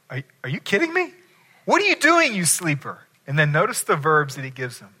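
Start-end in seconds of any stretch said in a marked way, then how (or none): 0.1-3.0 high-pitched mock disbelief, jumping higher; incredulous wake-up scolding, voice shooting up. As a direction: act out a captain who can't believe his eyes. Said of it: "He's incredulous".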